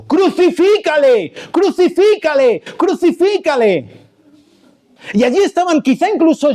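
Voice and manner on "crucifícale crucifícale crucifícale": high, insistent mob chant; acted crowd shout. Preacher acting out the same crowd five days later, shouting. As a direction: imitate a crowd yelling, pitched well up. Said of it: "estos mismos estaban gritando".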